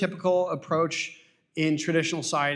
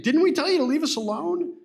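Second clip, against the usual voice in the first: much higher, whining mock of the Israelites; whiny complaint; 10 semitones higher, 3 dB louder than usual. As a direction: whine it like a spoiled child. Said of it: "So they're really whiny here".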